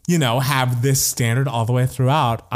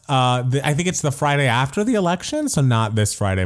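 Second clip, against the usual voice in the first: ordinary delivery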